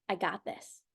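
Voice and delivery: voicing the body as a quiet, steady inner coach; quiet confident body voice. Coach voices the body answering the doubting mind, quiet and steady.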